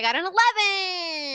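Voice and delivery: thrilled shout; excited. Real, not acted. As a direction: cheer the roll. Big dice roll success.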